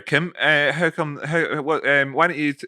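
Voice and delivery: ordinary delivery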